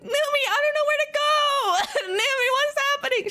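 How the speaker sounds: very high, hammed-up wail; high mock-crying plea; helpless wail. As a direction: wail it like someone hopelessly lost. Acted helpless plea to friend.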